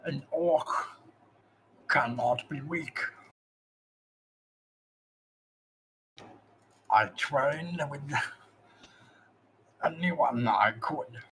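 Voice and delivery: slow, halting orc voice in pain; pained orc master. Acted. Zog confesses his weakness to the player, slow pained orc. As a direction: force out orc ways as if it hurts. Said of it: "almost like he's in pain when he says orc ways".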